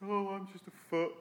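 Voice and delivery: a small, meek foot voice; voiced foot. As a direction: speak as a humble little foot. Foot speaks in a parable.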